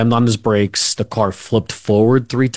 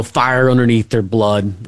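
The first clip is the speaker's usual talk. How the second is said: normal talking voice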